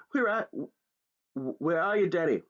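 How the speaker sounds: high, stumbling loud call. Acted. Father calling for his son in the dark, loud. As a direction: call out, catching on the words. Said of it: "my father called out".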